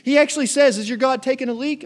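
lightly acted taunt; mocking. Preacher as Elijah teasing the prophets of Baal, mocking. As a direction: poke fun at their useless god. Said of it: "He starts making fun of them".

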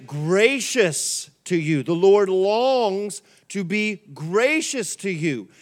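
slow, drawn-out scripture reading, word by word; leading unison reading. Real, not acted. Pastor leading the congregation in reading Isaiah together, slow and emphatic. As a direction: stretch each phrase so everyone can read along. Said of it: "Let's read this together".

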